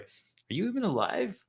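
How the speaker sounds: high, slow amazed question; startled question. Pretend. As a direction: gasp at a stranger. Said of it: "we bump someone else".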